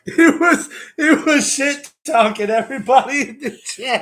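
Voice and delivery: high, loud, laughing through words. Real, not acted. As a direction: giggle while telling it. Laughing retelling of joke.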